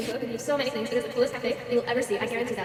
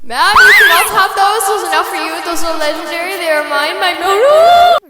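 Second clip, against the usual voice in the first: loud protest; 6 semitones higher, 14 dB louder than usual. Acted. Worker protects legendary beans.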